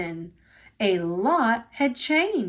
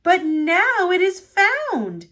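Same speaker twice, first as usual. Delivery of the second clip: a higher, louder happy cheer; 8 semitones higher, 4 dB louder than usual. Acted. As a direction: celebrate the good news. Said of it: "cheered Thomas".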